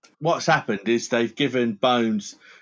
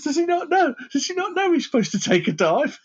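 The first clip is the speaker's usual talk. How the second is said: much higher, indignant squeal; high outraged voice. Retold or imitated. The boxer objecting to his manager, in a much higher outraged voice.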